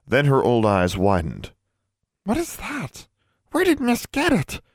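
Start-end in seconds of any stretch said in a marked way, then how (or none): 2.2-4.6 very high, startled elf squeak; alarmed high-pitched questions. A narrator's reading. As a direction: ask it way up in your head voice. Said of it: "she asked in a high-pitched tone".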